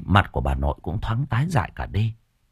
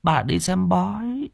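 sighing grandmother; 7 semitones higher than usual. Acted. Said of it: "Bà nội mới thở dài".

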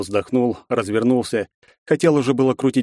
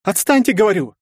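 put-on frightened girl; 11 semitones higher, 4 dB louder than usual. Girl repeats her refusal.

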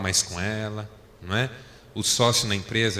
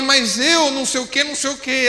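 higher and louder, heated back-and-forth; raised voice in a quarrel; 13 semitones higher, 6 dB louder than usual. Retold or imitated. Husband heated in the retold argument with his wife. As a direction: keep the argument going, voice up. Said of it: "subir um pouco a voz".

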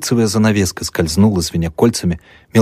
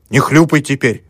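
stern rebuke; 8 semitones higher, 5 dB louder than usual. A narrator's reading. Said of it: "строго одёрнула мать".

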